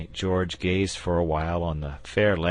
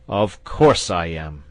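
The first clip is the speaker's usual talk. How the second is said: slow, much louder assured reply; confident; 9 dB louder, slower than usual. A narrator's reading. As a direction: stake his word on it. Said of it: "with confidence".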